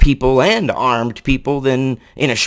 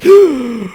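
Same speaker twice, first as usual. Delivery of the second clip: sharp, high, loud gasp of fake shock; hammed-up gasp; mock gasp; 11 semitones higher, 5 dB louder than usual. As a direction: gasp like the virus is startled. Covid shocked by BLM.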